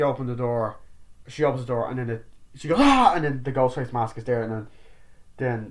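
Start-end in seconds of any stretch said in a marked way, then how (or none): 2.8-3.1 loud high mock scream. Sydney opening the door to the mask, loud scream. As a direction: let out a startled shriek.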